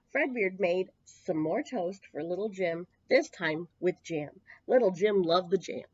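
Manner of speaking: ordinary delivery, nothing marked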